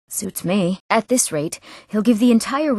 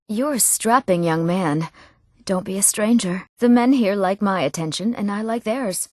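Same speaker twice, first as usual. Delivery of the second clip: ordinary delivery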